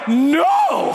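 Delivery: long, drawn-out shouted no; hammed-up panic at the plane door; panicked refusal. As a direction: cry out in mock terror. Refuses to jump at the count.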